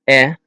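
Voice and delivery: E as the short eh of bet. Shown as a demonstration. Teacher showing the first Dutch E sound, short eh. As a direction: say E like the vowel in bed. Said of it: "E can be pronounced in general in three different".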